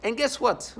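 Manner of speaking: usual speaking voice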